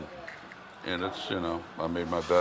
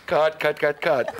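loud, high mock director's call; calling cut; 7 semitones higher, 6 dB louder, slower than usual. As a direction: call a halt on set, voice pitched up. Acts stopping a film take.

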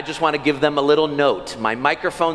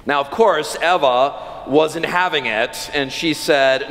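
ordinary talk

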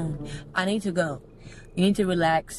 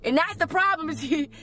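higher-pitched, fed-up protest; irritated; 5 semitones higher than usual. Real, not acted. Friend protests to the prankster leaning over, irritated. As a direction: complain with real irritation. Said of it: "that's why I'm getting irritated".

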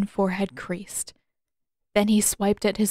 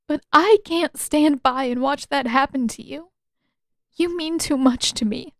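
put-on higher, tearful young woman, pleading and louder; Katara pleading; 6 semitones higher, 3 dB louder than usual. A narrator's reading. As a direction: beg him through rising tears.

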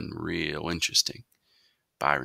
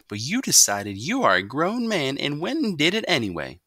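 higher, firm laying down judgment; stern mother; 8 semitones higher, 5 dB louder than usual. A narrator's reading. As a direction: tell him he's done for. Mother pronounces sentence.

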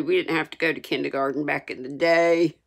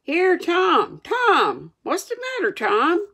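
put-on high, urgent boy's call; worried, high-pitched questioning; anxious calling; 13 semitones higher, 4 dB louder, slower than usual. A narrator's reading. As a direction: call out in rising concern. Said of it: "looked in his face anxiously".

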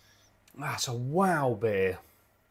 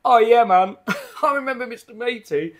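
much higher and louder, laughing recognition; delighted viewer; 9 semitones higher, 9 dB louder than usual. Retold or imitated. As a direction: play a fan cheering at an old memory. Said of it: "Or you're gonna go".

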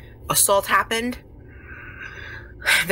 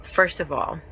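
normal talking voice

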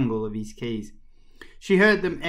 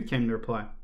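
ordinary delivery

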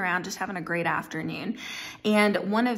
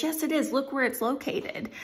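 acted-out higher-pitched comeback; insistent retort; 7 semitones higher than usual. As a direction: argue back in a mock quarrel, voice jumping up. Friend argues back in parable.

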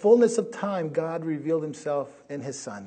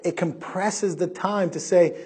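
usual speaking voice

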